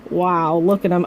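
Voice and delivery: ordinary delivery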